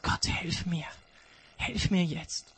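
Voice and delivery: a whispered plea to God in the dark; hushed plea for help; quiet prayer. Acted. Climber prays for help.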